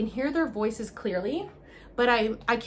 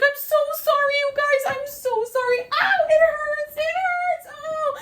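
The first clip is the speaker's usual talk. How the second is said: very high, loud wailing; hysterical crying; 6 dB louder than usual. Retold or imitated. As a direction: wail apologies through sobs. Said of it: "So it's like".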